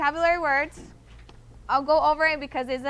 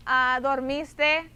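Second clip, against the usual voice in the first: ordinary delivery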